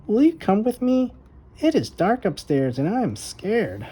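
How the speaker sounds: put-on a small girl nervous of the dark; little girl Violet. A narrator's reading. Little sister begging her brother to come upstairs, timid high voice.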